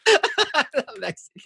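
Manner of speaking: put-on slow, high, laughing Mexican accent. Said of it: "the worst Mexican fucking accent".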